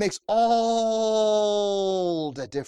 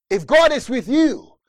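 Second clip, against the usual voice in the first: ringing call; 5 semitones higher, 7 dB louder than usual. Acted. Preacher calls out.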